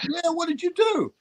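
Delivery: very high-pitched, disbelieving imitation; retold incredulity. Agent baffled by the job offer, incredulous. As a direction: demand it in sheer amazement, voice way up.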